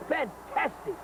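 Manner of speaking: hammed-up rising Fantastic. Real, not acted. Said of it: "I'm getting all excited".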